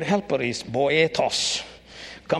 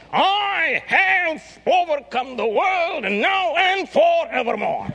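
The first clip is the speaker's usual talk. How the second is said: triumphant shout; 5 dB louder than usual. Acted. Preacher proclaiming Jesus' victory, a high triumphant shout.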